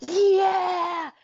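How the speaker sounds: loud, high cheer of delight; excited shout. Real, not acted. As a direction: whoop it out with glee. Excited about next episode.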